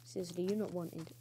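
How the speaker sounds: ordinary delivery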